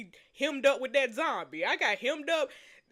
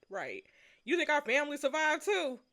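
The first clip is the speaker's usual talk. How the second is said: normal talking voice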